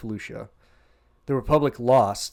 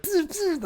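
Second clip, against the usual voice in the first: very high buzzing saber sounds; lightsaber swooshes. Retold or imitated. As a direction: imitate blades slicing. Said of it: "he's just like".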